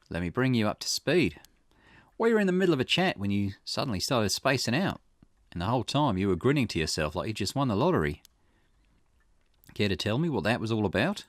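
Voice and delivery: lightly voiced investigator, casually probing; Richter. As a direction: question a client in a light character voice. Voices Richter in game dialogue.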